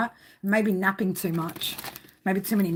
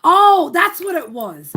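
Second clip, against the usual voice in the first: sudden realization; 7 semitones higher, 10 dB louder, slower than usual. Real, not acted. Suddenly remembers the spirit's message.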